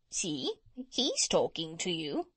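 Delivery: talking parrot with wide swoops. A narrator's reading. Parrot pointing out the dog to the doctor, swooping bird voice. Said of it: "the parrot said to the doctor".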